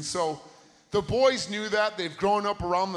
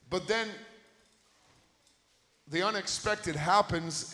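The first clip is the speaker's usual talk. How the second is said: usual speaking voice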